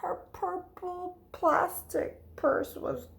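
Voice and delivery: slow, whispered loss; soft sorrowful whisper; heartbroken whisper. A narrator's reading. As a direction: let the sadness sink it. Sad loss in narration.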